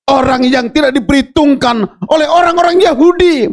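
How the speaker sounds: high, loud pulpit preaching building with force; fiery preaching. Acted. Pastor rousing the congregation about Samgar, fiery preaching. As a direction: preach it out to the whole church.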